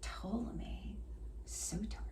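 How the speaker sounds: a hushed, secret whisper of the name; whispered reverently. Acted. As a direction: breathe the name like a mystery. Mysterious name dropped in whisper.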